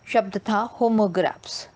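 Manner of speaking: normal talking voice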